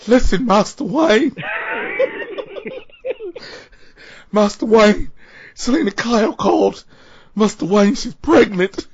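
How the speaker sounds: high, loud butler voice cracking with laughter; hammed-up Michael Caine. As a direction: play the butler while cracking up. Michael Caine impression skit.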